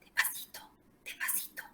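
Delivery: put-on hushed whisper to a child; soft whisper. Shown as a demonstration. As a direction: whisper it gently to bring his volume down. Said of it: "hay que hablarle bajito". (susurro bajito para un niño)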